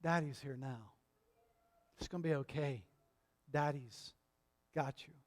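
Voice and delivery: a father calming his son, quietly reassuring; calm, soothing dad. Retold or imitated. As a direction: soothe a frightened toddler gently. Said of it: "just the calmness of".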